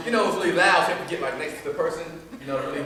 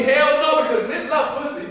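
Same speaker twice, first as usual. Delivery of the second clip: loud, higher mock-indignant woman; quoted woman; 13 semitones higher, 10 dB louder than usual. Poet voicing the woman's loud refusal. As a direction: blurt it like a brash club girl. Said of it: "she told me".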